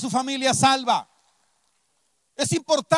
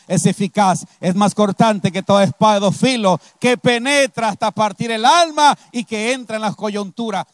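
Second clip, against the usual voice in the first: preaching; 4 semitones lower, 4 dB louder than usual. Acted. Preacher declares the power of the word to the congregation, preaching forcefully. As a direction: build into louder, forceful preaching.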